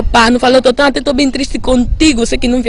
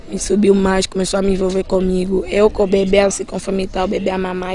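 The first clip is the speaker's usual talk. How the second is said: ordinary talk, nothing marked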